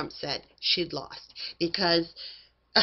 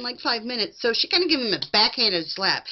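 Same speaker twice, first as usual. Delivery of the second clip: ordinary delivery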